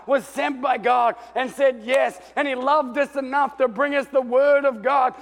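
loud, rising preaching as the thankful city; grateful city shouting. Acted. The saved city loudly thanks Jonah for saying yes to God. They would have said.

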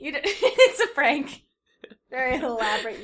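cracking up mid-line; laughing. Real, not acted. Reading the fanfic and cracking up, laughing.

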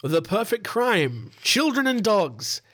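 slow, drawn-out quote to the judge; mock courtroom lawyer. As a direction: quote it like a prosecutor addressing the court. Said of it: "the defendant said, and I quote".

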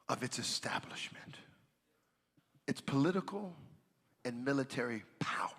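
softly whispering God's promise; hushed whisper. Acted. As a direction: breathe the promise in awe. God's words spoken hushed.

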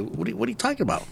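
high, fast, soft mock confusion; imitating bewildered immigrants. Newcomers baffled at being sold dirt, high quick imitation. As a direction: rattle it off high and puzzled. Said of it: "and they're like".

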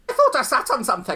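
put-on high, loud prim old lady. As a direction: play a flustered old dear. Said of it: "And she said".

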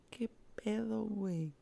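"¡Qué pedo" in a high, sobbing wail. Retold or imitated. Crying out over her mother, high pitched wail. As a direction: cry it out high and broken. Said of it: "de esos llantos de moco".

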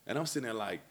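normal talking voice

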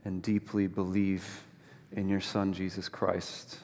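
low, soft, unhurried praying voice; prayer. Real, not acted. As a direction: lead a quiet prayer, pausing between phrases. Closing a spoken prayer.

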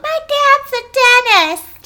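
put-on very high, slow, loud kid voice; mock child's voice, drawn out; imitating little kids. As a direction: squeal it like a proud little kid. Imitating kindergarten kids answering.

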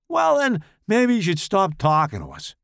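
high, loud retort; snappy Tommy voice. Acted. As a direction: bite back at him. Tommy snaps back.

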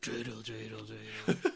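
dramatic sung impression; Clint Eastwood. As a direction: sing it like a dramatic movie cowboy. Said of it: "Clint Eastwood dramatically sings the dreidel song".

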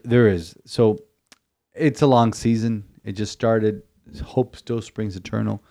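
usual speaking voice

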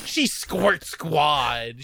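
stretched slow, teasing; drawn-out mock. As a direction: draw every word out slowly. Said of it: "Shibuya's over here like".

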